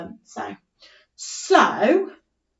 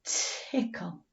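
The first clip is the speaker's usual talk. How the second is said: whispered word; 3 semitones lower, 12 dB quieter than usual. Shown as a demonstration. Teacher whispering the word to children. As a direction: whisper tickle softly. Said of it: "what sounds can you hear in the word tickle".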